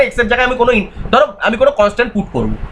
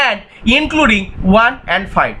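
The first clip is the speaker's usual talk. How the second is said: very slow restatement; much slower than usual. Shown as a demonstration. Teacher restates the rule slowly.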